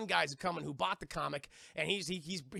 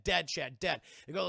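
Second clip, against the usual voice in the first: ordinary talk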